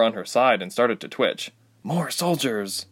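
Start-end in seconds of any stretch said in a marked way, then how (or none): 1.8-2.9 slow, inward musing; inner thought. Acted. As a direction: think it slowly to yourself. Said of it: "Ilse thought to herself".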